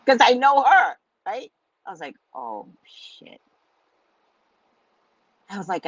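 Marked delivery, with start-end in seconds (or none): 2.3-3.4 whispered dismay. Retold or imitated. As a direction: whisper "Oh, shit" in quiet dread. Realizing sister's prediction, whispered.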